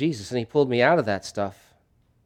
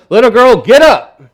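plain, direct call, much louder and higher; just tell her to get up, no magic; simple command; 9 semitones higher, 12 dB louder than usual. Retold or imitated. Jesus simply telling the dead girl to rise, loud plain command. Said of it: "he simply says".

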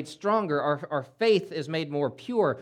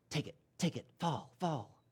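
much softer, coaxing tempter voice; tempter's whisper; 10 dB quieter than usual. Acted. Preacher voicing a tempter luring believers, soft and coaxing. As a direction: whisper like a devil luring them in.

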